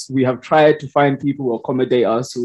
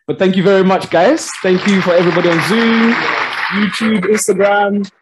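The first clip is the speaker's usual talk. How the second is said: loud excited shout-out; 7 semitones higher, 4 dB louder than usual. Real, not acted. Host's loud sign-off to viewers.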